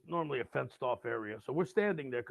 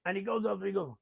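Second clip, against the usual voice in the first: ordinary delivery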